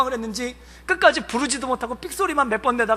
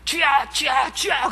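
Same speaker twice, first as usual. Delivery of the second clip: crowd shout; slower than usual. Retold or imitated. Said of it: "그들이".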